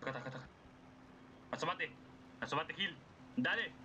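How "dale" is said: impatient. Real, not acted. Goads hidden enemy, first try.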